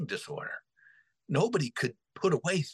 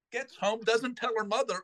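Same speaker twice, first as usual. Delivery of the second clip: ordinary talk, nothing marked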